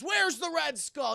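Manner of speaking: mock interrogation. Hero questioning a thug over the air duct, loud demand. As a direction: demand it slowly and loudly. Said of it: "and he says".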